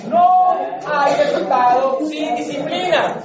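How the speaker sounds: slow, firm voice of discipline; voice of the value. Retold or imitated. Coach voicing what the value says, slow and firm. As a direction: lay it down slowly like a stern inner rule. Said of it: "el valor le diga".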